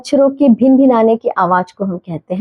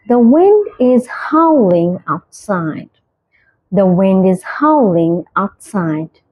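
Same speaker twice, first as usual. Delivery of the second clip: very slow, each word spaced out; slow clear example; 3 semitones lower, much slower than usual. Shown as a demonstration. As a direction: model the sentence slowly for learners. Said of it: "example sentence read slowly". (धीरे, साफ़ उदाहरण वाक्य)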